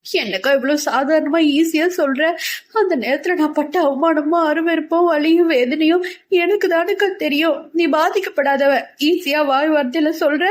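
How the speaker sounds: high, wounded, tearful protest; hurt, tearful younger sister. Acted.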